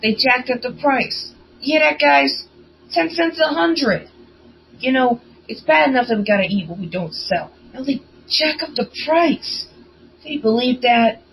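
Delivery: angry. Acted. Blink telling the guys about the price hike, angry. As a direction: vent loudly as an outraged newsie. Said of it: "Blink said so angrily, flames were practically shooting".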